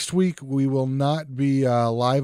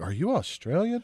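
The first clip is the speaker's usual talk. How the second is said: imitated guessing; 5 semitones higher, 6 dB quieter than usual. Imitating people guessing the accent.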